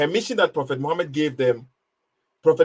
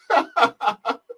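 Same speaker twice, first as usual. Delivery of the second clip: short high mocking laugh; 12 semitones higher than usual. Real, not acted. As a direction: laugh him off, high and scornful. Laughing at the caller's question.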